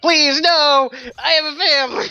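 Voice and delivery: high, loud hammed-up begging; mock pleading. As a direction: beg for mercy, hammed up. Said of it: "they're like".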